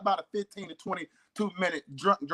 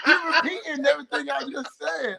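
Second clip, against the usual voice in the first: laughing; 9 semitones higher than usual. Real, not acted. Laughs at friend repeating him.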